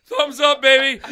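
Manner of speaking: high, loud triumphant shout; hammed-up victory whoop; mock cheer. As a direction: yell it like a champ. Cheering as carried off.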